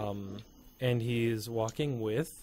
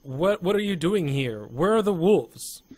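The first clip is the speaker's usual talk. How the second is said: higher, louder demand; raised-voice interrogation from afar; calling out; 5 semitones higher, 5 dB louder than usual. Acted. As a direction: call out urgent questions to strangers. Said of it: "call out in Elven".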